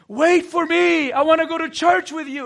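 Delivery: high, loud desperate call; yelling after the car. Retold or imitated. Friend chasing the car, yelling to be taken along. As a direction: shout it after a car pulling away. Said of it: "He's yelling".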